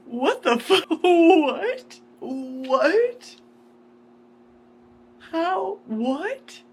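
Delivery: high, loud, sputtering what; stunned disbelief. Real, not acted. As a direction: caught off guard by the twist. Stunned by a plot twist.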